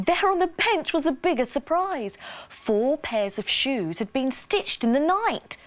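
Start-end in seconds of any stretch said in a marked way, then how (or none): none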